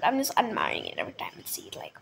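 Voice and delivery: whispered admiring aside; fast whisper. Real, not acted. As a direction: rush it out in a hushed voice. Private aside about shirt.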